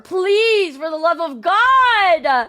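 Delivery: loud high pleading. Real, not acted. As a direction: beg them, rising in pitch. Pleading with chat about honesty.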